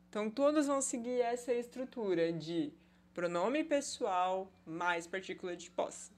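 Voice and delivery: normal talking voice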